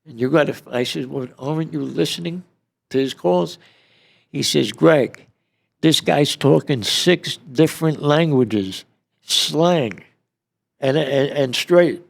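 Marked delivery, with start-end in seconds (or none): 1.1-3.6 high-pitched retold question; incredulous challenge. Inmate questions agents about the tapped calls, incredulous. 4.7-12.0 high, loud acted complaint; exasperated explanation. FBI agent explains to Greg why they cannot follow, exasperated.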